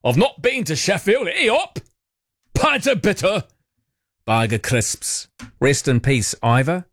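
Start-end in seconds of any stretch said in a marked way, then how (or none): 0.0-1.3 put-on broad, higher northern English accent; hammed-up Sheffield accent; British accent. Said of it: "slipped into a British accent". 1.3-5.3 much higher, hammed-up Yorkshire accent, slowing right down at the end; broad comic northern English accent; British accent. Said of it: "British accent".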